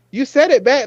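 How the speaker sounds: high, loud accusing cry; exasperated accusing. Retold or imitated. Calling Shane out for saying Ilya back, high loud accusation. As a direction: call him out, outraged. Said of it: "And I'm just like".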